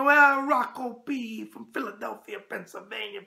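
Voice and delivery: slow, drawn-out host persona voice; hammed-up New York host. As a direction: roll out the viewer's name like a showman. Host catchphrase delivery.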